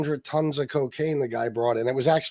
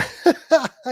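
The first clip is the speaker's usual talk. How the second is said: high laugh; 12 semitones higher, 5 dB louder than usual. Real, not acted. Laughing at chat.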